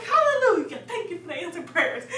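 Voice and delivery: high, loud hallelujah with a laugh; jubilant praise. Retold or imitated. Couple thanking God for the wheelchair, retold jubilant praise. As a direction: cry out high in mock rejoicing. Couple rejoices at answered prayer.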